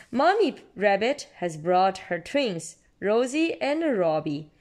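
plain reading voice

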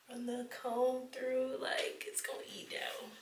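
soft excited whisper. Real, not acted. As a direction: breathe the words out in a hush. Excited hush over styling hair.

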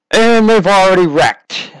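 loud, high burst of alarm; excited shout. Real, not acted. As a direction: shout it as the wreck happens. Sudden crash in live commentary.

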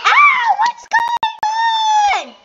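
very high, loud, slow shrieks; panicked screaming. Real, not acted. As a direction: scream it out in alarm.

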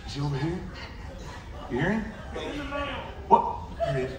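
normal talking voice

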